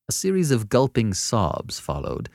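ordinary delivery

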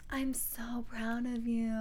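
soft, slow seductive voice; sexy voice. Retold or imitated. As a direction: purr it slowly and softly. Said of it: "that's a super sexy voice".

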